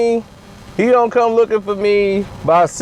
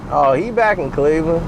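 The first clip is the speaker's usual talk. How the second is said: much lower, laid-back messenger voice; a much lower, mellow messenger; James Jones impression; 7 semitones lower than usual. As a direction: drop way down and play it easy. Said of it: "send James Jones".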